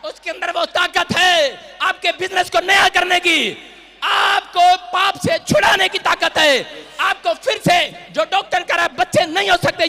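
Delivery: loud, high rallying sermon; full-force preaching. Acted. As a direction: preach it at a shout, stretching key words.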